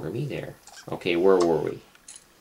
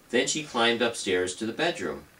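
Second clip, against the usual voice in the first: plain reading voice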